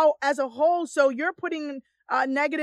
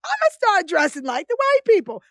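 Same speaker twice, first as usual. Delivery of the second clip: playful mock resolve; 4 semitones higher, 5 dB louder than usual. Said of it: "I said".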